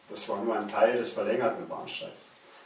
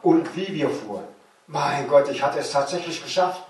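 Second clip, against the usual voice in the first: higher and louder, overjoyed; triumphant joy; 5 semitones higher, 6 dB louder than usual. A narrator's reading. Boy who escaped the East, rejoicing in the train. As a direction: celebrate like you finally made it.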